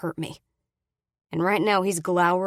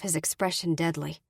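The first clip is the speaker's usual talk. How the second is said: ordinary delivery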